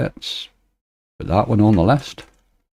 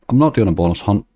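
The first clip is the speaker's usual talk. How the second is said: normal talking voice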